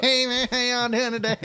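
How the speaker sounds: high, loud lazy-lipped Southern greeting; Southern drawl. Acted. Mimicking a Southern man's greeting with lips open. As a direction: lips loose, sing out the drawl. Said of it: "when they don't close their lips".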